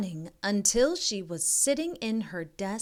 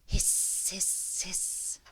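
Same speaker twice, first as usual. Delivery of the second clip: hissing fading softer each time; drawn-out unvoiced snake hiss; 4 dB quieter, slower than usual. A narrator's reading. Snake hissing sound effect.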